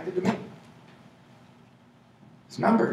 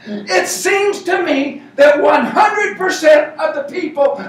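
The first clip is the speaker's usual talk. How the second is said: higher, louder, slow oratory; mock proclamation; 10 semitones higher, 5 dB louder, slower than usual. Shown as a demonstration. Speaker proclaims his networking twist to the audience, mock grand. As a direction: declare it like a famous quote. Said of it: "mock-proclaims his own twist".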